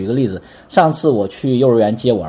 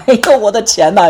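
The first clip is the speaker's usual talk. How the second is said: much higher, louder cry of dismay over money; dismayed wail; 10 semitones higher, 6 dB louder than usual. Retold or imitated. Pastor imagining the father crying over lost money, dismayed. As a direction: wail like a father mourning his cash. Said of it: "他爸爸有没有说".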